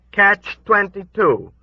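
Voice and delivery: put-on high, slow old woman's reply. A narrator's reading.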